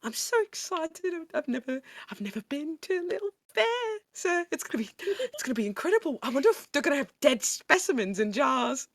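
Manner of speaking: high, breathless and fast with delight; excited girlish voice. Acted. Penelope gushing to Salem about the fair, high and giddy. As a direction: gush like an eager little girl at her first fair.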